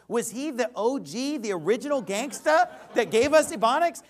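loud, high preaching at full force; fiery preaching. Acted. Pastor thundering Paul's words at the congregation. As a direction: drive the verse home from the pulpit.

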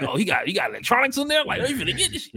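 high-pitched laughing impression of schemers; imagined neighborhood thieves. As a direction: act out excited onlookers, high and amused. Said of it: "like, they be like".

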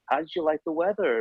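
high-pitched put-on New York accent; Paula Scher. Designer makes small talk with a traveller, mock New York accent. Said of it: "That accent is amazing".

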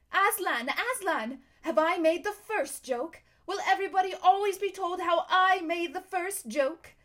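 high, loud, eager bird voice; excited jackdaw. A narrator's reading. Jackdaw asking Aslan about the first joke, excited and loud. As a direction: crow it proudly, high and loud.

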